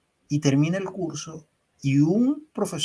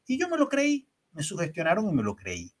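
normal talking voice

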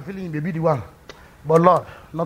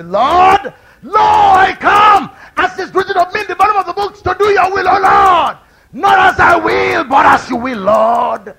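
high, loud, slow cry to the Lord; shouted prayer; 11 semitones higher, 8 dB louder, slower than usual. Acted. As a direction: pour out surrender at full voice. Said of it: "submit yourself and say".

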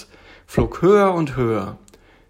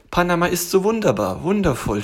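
high, gushing tiger voice; delighted little tiger; 7 semitones higher, 4 dB louder than usual. Acted. Tiger praises Panama to bear, delighted. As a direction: gush in a high, happy voice.

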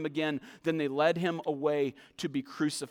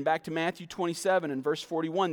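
ordinary delivery

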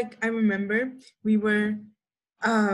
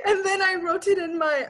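laughing; 9 semitones higher than usual. Real, not acted. Laughing at the memory.

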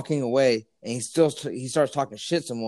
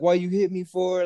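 higher, whiny mock complaint; the slapped guy whining, voiced higher; imitating the other guy; 7 semitones higher than usual. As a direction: play the guy protesting. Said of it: "the guy's like".

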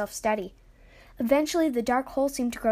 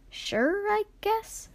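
high-pitched, unsure agreement; hesitant, pitched-up reply; shrugging; 10 semitones higher, much slower than usual. A narrator's reading. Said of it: "Jax shrugged".

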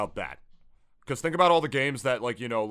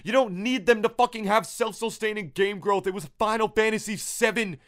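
high-pitched, exasperated arguing; heated rant; 6 semitones higher than usual. Real, not acted. Co-host insisting Final Fantasy sells anyway, heated rant. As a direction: keep arguing hotly, voice pitched up.

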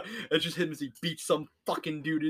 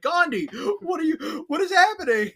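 much higher, loud crying wail; mock distressed cry; 6 dB louder than usual. Player pleading with Gandhi, distressed cry. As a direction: wail in despair.

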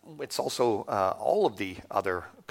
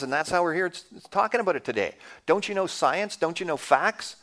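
higher-pitched, climbing in mock disbelief; mock scoffing skeptic; 4 semitones higher than usual. Skeptic lecturing a believer on evolution, scoffing and incredulous. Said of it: "ever heard this type of thing".

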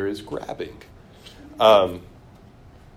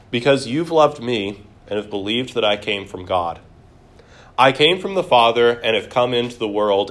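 steady, raised Bible reading voice; a firm reading of Jesus' words; scripture reading; 3 semitones higher, 5 dB louder than usual. Acted. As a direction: read the passage out to the class with weight. Reads scripture aloud.

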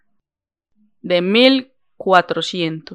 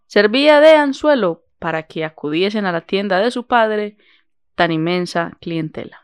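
story narration; 4 dB louder than usual. Acted. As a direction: read it like an old tale. Reads the story aloud.

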